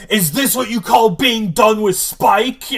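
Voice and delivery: loud, high-pitched imitation of outrage; acted angry accusation. Acting out Xander's angry accusation.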